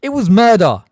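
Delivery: loud, high angry cry; loud accusation. A narrator's reading. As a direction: fling the word at her. Harry shouts at Umbridge.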